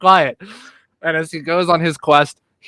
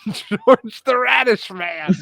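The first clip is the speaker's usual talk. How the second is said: mock weeping; 10 semitones higher than usual. Mock sobbing over his story.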